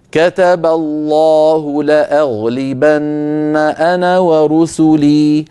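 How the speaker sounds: slow, chanted recitation with full open vowels; slow Quran recitation. Shown as a demonstration. Teacher reciting slowly for students, each fatha open. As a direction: recite every fatha wide open, unhurried. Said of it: "يفتح القارئ فمه".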